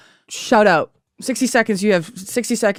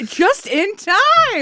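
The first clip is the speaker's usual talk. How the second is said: excited; 13 semitones higher, much slower than usual. Real, not acted. Timer ends.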